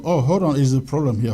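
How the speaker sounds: normal talking voice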